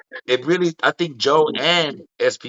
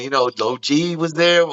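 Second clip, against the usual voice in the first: usual speaking voice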